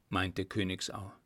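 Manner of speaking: usual speaking voice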